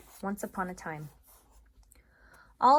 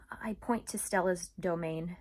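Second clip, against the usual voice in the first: plain reading voice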